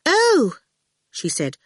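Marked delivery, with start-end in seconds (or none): 0.1-0.6 high, loud "oh" of dismay. A narrator's reading. Miss Potter opening her door to them, dismayed. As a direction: let out a high-pitched, put-out oh. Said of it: "looked dismayed".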